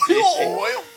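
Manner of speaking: laughing mock yell. Repeating yelled oil, laughing.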